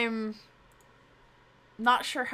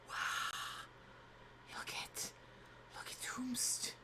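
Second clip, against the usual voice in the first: whisper; 17 dB quieter than usual. Real, not acted. Whispering over the pets.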